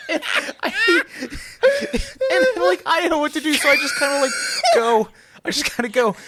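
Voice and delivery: very high, squeaky laughing speech; helpless laughter. Real, not acted. Friend recounting not knowing what to do, cracking up. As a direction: barely get the words out for laughing.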